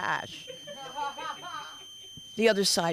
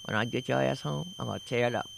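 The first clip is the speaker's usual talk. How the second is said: low, soft menace with lips barely moving; ventriloquist threat; 6 semitones lower, 6 dB quieter than usual. Retold or imitated. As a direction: mutter a threat without moving the mouth. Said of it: "They're like ventriloquists".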